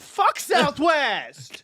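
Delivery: drawn-out shouted "fuck Southwest"; slow angry shout. Real, not acted. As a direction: bellow it slowly, disgusted. Ranting about hated airline.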